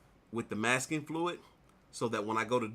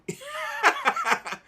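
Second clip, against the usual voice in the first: a high burst of real laughter, much higher; 6 dB louder than usual. Real, not acted. Laughing at the salesman's blunt verdict about money. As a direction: laugh out loud at the memory.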